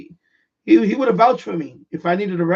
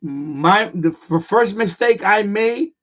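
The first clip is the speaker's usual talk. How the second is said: ordinary talk, nothing marked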